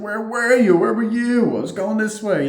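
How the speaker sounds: high, loud mock outburst; mock frantic calling. John asking where they went, high mock frantic yell. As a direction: imitate a frantic shout, pitched high. Acted frantic exchange from the film.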